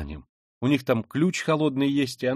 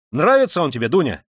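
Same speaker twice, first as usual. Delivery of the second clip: high, loud, lively question; excited, provocative query; animated; 5 semitones higher, 8 dB louder than usual. A narrator's reading. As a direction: ask with sudden boisterous interest. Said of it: "с каким-то необыкновенным до сих пор оживлением".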